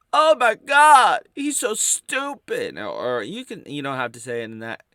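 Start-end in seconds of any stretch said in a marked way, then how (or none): 0.1-2.8 much higher, much louder, slow squeal; hammed-up high hater outrage; mocking hater voice. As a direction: shriek it in scandalised disgust. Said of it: "in that tone of voice".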